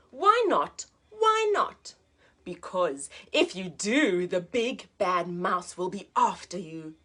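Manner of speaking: put-on lively read-aloud for children; teacher reading a picture book to a child, lively; storybook narration. A narrator's reading.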